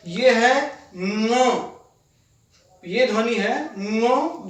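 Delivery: ङ as a drawn-out velar nasal from the throat; ङ held long through the nose; very slow teacher's ङ; slow nasal demo. Shown as a demonstration. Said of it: "जो कंठ से बोली जाती है".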